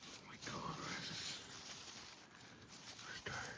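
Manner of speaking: hushed whisper while hunting beetles; whispering to himself while searching the leaves. Real, not acted. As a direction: breathe the words, barely voiced.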